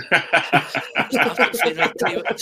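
laughing hard while talking. Real, not acted. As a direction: get the words out through laughter. Bursting out laughing.

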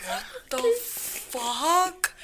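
drawn-out disbelief; slow shocked. Retold or imitated. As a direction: stretch it in shock.

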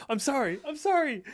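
high, rising frantic sorry; panicked apology. Retold or imitated. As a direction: squeak it out while running off. Mordecai apologizing while fleeing.

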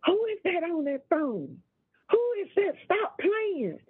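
high, agitated mock yell at wife; husband yelling. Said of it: "my husband was behind me like".

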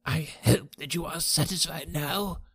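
tartly satisfied-with-yourself jab. A narrator's reading. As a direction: say it tartly, keeping the volume down. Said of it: "said Mister Cunningham tartly".

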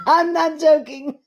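laughing through words; can't stop laughing. Real, not acted.

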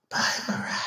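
unvoiced fading whisper. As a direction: whisper goodbye. Ghost fades away.

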